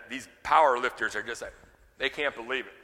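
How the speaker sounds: ordinary delivery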